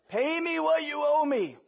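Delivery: high, slow, strained threat; menacing demand. Acted. Servant choking his debtor, slow menacing demand. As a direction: squeeze each word out as a threat.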